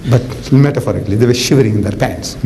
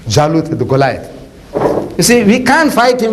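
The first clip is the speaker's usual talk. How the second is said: ordinary talk, nothing marked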